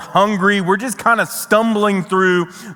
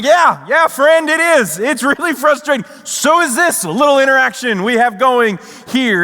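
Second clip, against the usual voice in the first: higher-pitched, worked-up frustrated comeback; exasperated retort; 6 semitones higher than usual. Retold or imitated. Traveler retorting to unhelpful security agent, exasperated. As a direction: let mock exasperation burst out at him.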